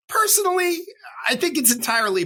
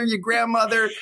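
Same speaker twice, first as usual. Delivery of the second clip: ordinary delivery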